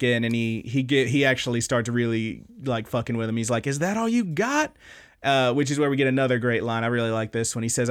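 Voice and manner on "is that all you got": mock taunt. Taunting quote in fight retelling.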